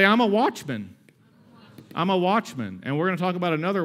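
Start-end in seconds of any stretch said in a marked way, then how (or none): 0.0-1.0 louder, higher lead line for the church to echo; preacher's call to the congregation; call-out for the crowd to repeat. Shown as a demonstration. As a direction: pitch it up and out so the crowd can repeat it.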